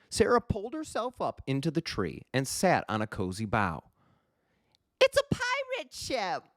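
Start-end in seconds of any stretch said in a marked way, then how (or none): 5.0-6.4 put-on high, thrilled girl calling to friends; excited shout. A narrator's reading. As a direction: call the others aboard. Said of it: "she shouted".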